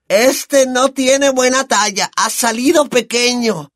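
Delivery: high, brash, boozy aunt voice; Aunt Marge. Acted. Aunt sneers at the family over brandy, high brash voice.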